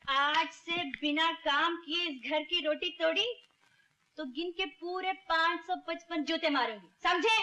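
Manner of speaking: threatening scolding. Acted. Woman warning Ranjit about work, threatening scolding. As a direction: lay down the law, pitch lifted.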